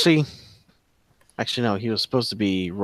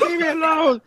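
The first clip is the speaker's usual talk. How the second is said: much higher, panicky begging voice; pleading captive. Acted. GM's captured rider begs the archer, high and panicked. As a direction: beg for your life in a high squeak. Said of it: "He's like".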